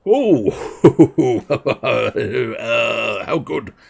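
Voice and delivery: chuckling king, pleased with the company; lightly voiced king. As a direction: play a jolly, hesitant king. Voices the hesitant old king.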